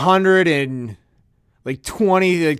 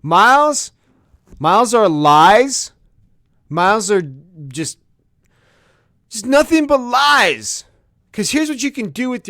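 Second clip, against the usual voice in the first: ranting shout; 8 semitones higher, 6 dB louder than usual. Real, not acted. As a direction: bellow it like a furious rant. Opening rant about airline miles.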